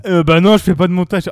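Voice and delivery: too loud into the mic; loud, clipping the mic; yelling. Real, not acted. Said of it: "Arrête de crier".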